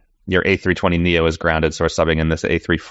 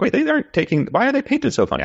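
high, puzzled squeak; confused surprise. Retold or imitated. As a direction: ask it in high disbelief. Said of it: "I'm like".

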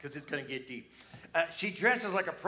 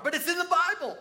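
high, loud burst of disbelief; emphatic exclamation; 13 semitones higher, 6 dB louder than usual. Real, not acted. Preacher exclaims at bizarre Bible story.

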